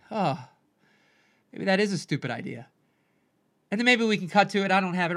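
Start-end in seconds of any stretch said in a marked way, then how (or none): 0.1-2.7 low, soft, crestfallen aside; deflated inner voice. Acted. Dreamer doubting the rock idea inside, deflated. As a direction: sink into quiet self-doubt after being mocked. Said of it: "inside you're like".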